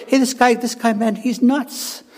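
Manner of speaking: put-on high-pitched aside among young preachers; young bucks talking. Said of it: "We say".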